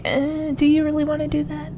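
quick, hushed second-guessing; hesitant aside. Retold or imitated. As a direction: drop the volume and gently question it. Hesitant aside answering the acted resolve.

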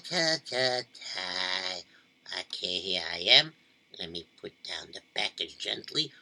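soft sneaking voice; very quiet. Acted. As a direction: keep it hushed.

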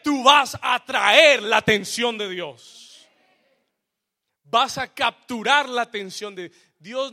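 loud, rising preacher's cry; full-force preaching. Acted. Preacher to his congregation, at full force. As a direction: preach it out to the whole church.

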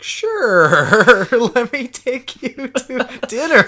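slow, chuckling through the words; laughing offer. Retold or imitated. Telling his buddies about the big guy, slow laughing reply. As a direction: laugh through the invitation, slowly. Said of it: "And I was like".

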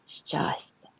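soft whisper; whispered ending. Acted. Rabbit in his mother's arms, whispering. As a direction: breathe the last word like a secret.

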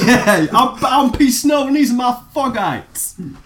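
high, laughing rapper brag. Pretend. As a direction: brag like a hip-hop star.

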